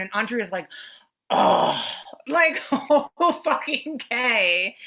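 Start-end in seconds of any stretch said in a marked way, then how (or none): 1.3-4.8 loud mock-exasperated groan, dragging out the last words. Viewer mimics a fed-up character reacting to new girlfriends, exasperated.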